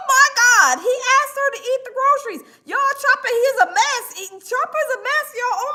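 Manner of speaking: usual speaking voice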